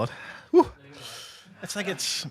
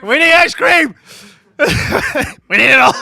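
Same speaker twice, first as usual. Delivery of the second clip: laughing shout; 12 semitones higher, 11 dB louder than usual. Real, not acted. Yelling for more, laughing.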